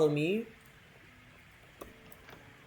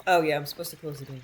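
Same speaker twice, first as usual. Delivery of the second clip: ordinary talk, nothing marked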